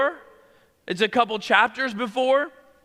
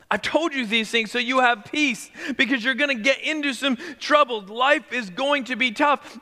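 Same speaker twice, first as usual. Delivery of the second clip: normal talking voice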